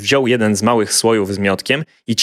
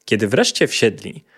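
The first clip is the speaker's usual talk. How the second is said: ordinary delivery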